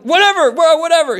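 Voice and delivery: loud, high-pitched giving in; exasperated surrender. Retold or imitated. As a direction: give in just to get it over with. Giving in to police, retold.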